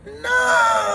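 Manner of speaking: very high, loud cry of no; mock desperate yell. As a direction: scream it in despair.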